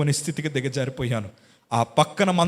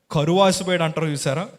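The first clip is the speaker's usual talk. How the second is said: ordinary delivery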